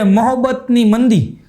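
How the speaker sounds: the film title drawn out slowly. Retold or imitated. Wife naming her film, said slowly. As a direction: spell out the title word by word.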